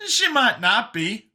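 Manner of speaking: high voice, holding out hope; Brian, offering hope. Acted. Brian reassuring grieving Vincent, high and hopeful.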